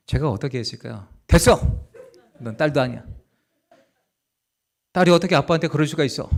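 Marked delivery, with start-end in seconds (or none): 1.3-6.3 hammed-up loud snap of a rejecting father; mock harsh father. Preacher pretends to reject his apologizing daughter, mock loud snap. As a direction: fake a cold slammed door at the child.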